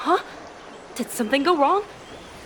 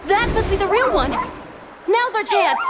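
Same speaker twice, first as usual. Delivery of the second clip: excited; 5 semitones higher, 4 dB louder than usual. Acted. Spotting the real target in battle.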